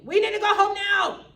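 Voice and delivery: very high, loud demanding shout; patient turning angry at family, shouting to go home. Retold or imitated. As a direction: demand to leave, furious. Sudden anger of patient voiced.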